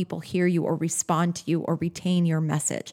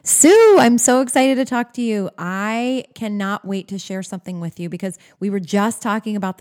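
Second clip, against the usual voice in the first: can't wait to share the great news; excited; 3 dB louder than usual. Shown as a demonstration. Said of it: "Do you notice the difference?".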